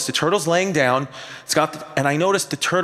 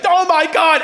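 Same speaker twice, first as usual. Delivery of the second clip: much higher, louder horrified cry; mock gasp; 11 semitones higher, 4 dB louder than usual. Wife crying out as the dog struggles. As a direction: cry it out in hammed-up horror. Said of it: "she's like".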